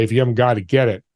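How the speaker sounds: ordinary talk, nothing marked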